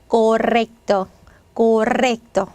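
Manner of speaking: correcto slowly, with a long trilled r; rolled r. Shown as a demonstration. Teacher modelling for students, correcto slowly with rolled r. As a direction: stretch the rr in correcto into a full trill. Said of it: "이렇게 발음해 주시면".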